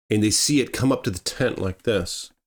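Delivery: ordinary delivery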